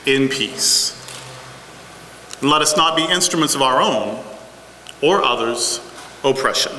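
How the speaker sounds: low, unhurried prayer voice. Acted. Pastor opening worship with a prayer, hushed and reverent. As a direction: lead the congregation gently into prayer.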